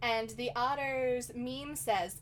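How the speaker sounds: ordinary talk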